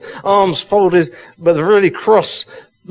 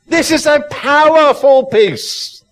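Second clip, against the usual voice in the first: powerful peace proclaimed; 10 semitones higher than usual. Real, not acted. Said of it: "I'm getting so excited".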